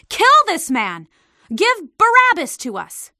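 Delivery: loud, high angry cry of a mob; crowd crying out. A narrator's reading. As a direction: hurl it as an enraged crowd. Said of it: "the crowd cried out".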